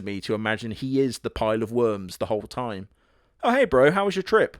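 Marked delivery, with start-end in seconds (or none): 3.4-4.6 cheery bro voice pitched up and loud; hammed-up chipper roommate; high, loud mock roommate. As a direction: greet him brightly and loudly. Voices worm monster roommate.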